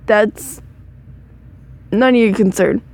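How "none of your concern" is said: ordinary delivery, nothing marked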